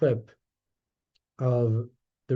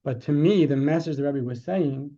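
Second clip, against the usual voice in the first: ordinary delivery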